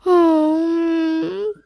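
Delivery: crying hum. Acted. Son weeping to his mother in hospital, crying hum. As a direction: let a long weepy hum out. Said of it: "तुम इतना रो क्यों रहे हो".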